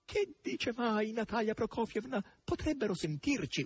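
high, begging plea; high imploring plea; imploring cry. A narrator's reading. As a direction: beg it anxiously. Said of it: "urlò il verro in tono di implorazione".

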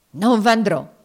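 loud, forceful refusal; defiant refusal. Acted. Master refusing to climb to the attic, defiant. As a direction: refuse outright, raising your voice. Legree refuses and then swears.

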